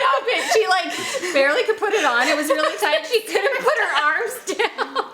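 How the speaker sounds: laughing. Real, not acted. Laughing about a tight dress.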